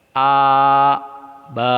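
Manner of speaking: long stretched A and ba; stretched syllables, marked wrong; wrongly drawn-out syllables. Shown as a demonstration. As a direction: demo of the wrong long reading.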